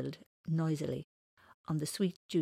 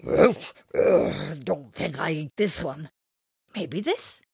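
pricked creature; 9 dB louder, slower than usual. Acted. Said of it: "it pricked his finger".

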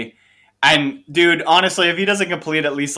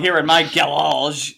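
French-style "garage", stressed second syllable stretched out; drawn-out, faux-French garage; mock French; slower than usual. Shown as a demonstration. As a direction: linger on garage as if it were French. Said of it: "like we're fucking French or something".

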